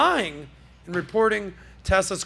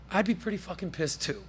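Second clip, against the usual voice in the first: normal talking voice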